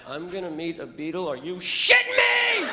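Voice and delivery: very high drawn-out shriek; inner freak-out. Retold or imitated. Fan inwardly learns he'll meet a Beatle, shrieking. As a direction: explode into a hammed-up screech of disbelief. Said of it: "And on the inside, I'm like".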